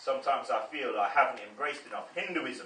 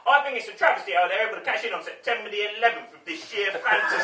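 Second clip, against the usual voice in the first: indignant fan; 7 semitones higher, 8 dB louder than usual. Retold or imitated. Angry fan with Tolkien book.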